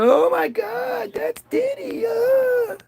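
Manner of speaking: mock squealing fangirl with a sob; star-struck fan squeal. Fans screaming at [name].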